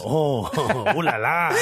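put-on laughing French accent, slow; hammy French chef voice. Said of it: "That's how he sounds".

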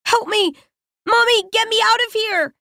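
put-on high, frightened little girl crying for help; trapped girl pleading. A narrator's reading. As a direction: plead like a child shut in the dark. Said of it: "faint words coming from within".

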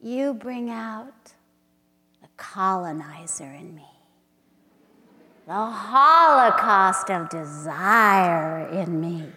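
slow, intimate poem recital with hushed drops; dramatic spoken-word reading; poetry reading. Acted. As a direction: read it like a love incantation. Reads her poem aloud.

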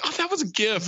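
high mock quote; tossed-off high voice; quoting a guy. Friend retelling a movie line, high mock voice. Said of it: "He's like".

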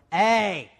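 put-on Southern kid mangling the Italian Eh into Hey; Southern drawl. Southern freshman attempting Italian E, Southern accent. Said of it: "as a Southern kid".

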